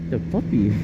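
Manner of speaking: ordinary talk, nothing marked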